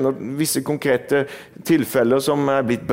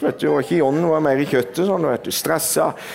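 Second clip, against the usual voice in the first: ordinary talk, nothing marked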